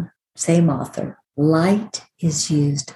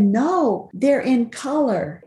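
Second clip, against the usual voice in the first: lightly voiced little boy; 7 semitones higher, slower than usual. Grandson insists on colors.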